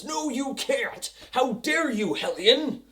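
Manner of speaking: hammed-up indignant passenger; mock outrage. Mocks outraged bus passenger.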